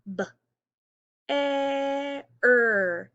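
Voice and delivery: b, e, r sounded out slowly, each sound held apart; sounding out words. Shown as a demonstration. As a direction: stretch bear into its sounds b, e, r.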